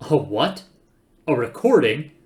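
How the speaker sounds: loud, disbelieving questions; incredulous. Acted. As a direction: echo it back in disbelief. Incredulous questions, much louder.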